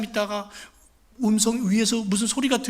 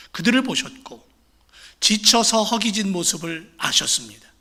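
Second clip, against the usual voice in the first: ordinary delivery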